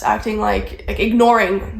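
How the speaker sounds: ordinary delivery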